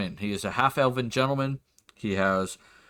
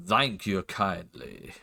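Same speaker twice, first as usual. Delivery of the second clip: put-on southern accent; 4 semitones lower, 5 dB quieter, slower than usual. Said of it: "I'm trying to do my southern accent".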